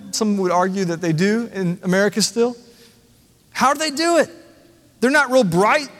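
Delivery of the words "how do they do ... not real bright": higher, puzzled voice of a skeptical world; baffled onlooker. Retold or imitated. Preacher voicing the watching world, baffled and higher. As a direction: ask it like a bewildered outsider shaking his head. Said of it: "it shakes its head and says".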